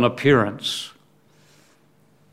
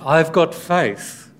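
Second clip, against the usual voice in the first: slow, drawn-out, higher-pitched claim; vague believer; 4 semitones higher, slower than usual. Acted. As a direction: mimic someone airily professing faith. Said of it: "some vague thing that says".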